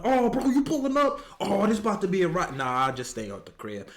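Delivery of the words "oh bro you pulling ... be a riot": much higher, fast hyped-up buddy voice; mock excited friend. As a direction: play the overexcited friend, fast.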